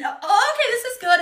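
put-on very high little-boy voice; high, laughing mock relief; imitated relieved boy. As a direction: mimic a kid deciding the ride is fine. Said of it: "then he starts screaming again".

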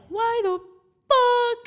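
high, loud outraged why the fuck; yelling at the unfairness of the breakup; indignant yell. Retold or imitated. Loud, high outraged yell at the breakup. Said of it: "So I was like".

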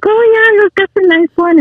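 high, delighted gushing over a ring; excited. Retold or imitated. Retelling admiring the ring to her fiance, high and delighted. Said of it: "and I was like".

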